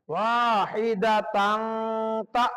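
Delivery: "তান" hummed long in the nose before a short "তা"; hidden nasal ghunna. Shown as a demonstration. Teacher showing ikhfa, "তান" held nasally before "তা". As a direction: hide the n of "তান" in a held nasal hum, then cut "তা" short. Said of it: "নাকের ভিতর লুকায় গুণনা করে".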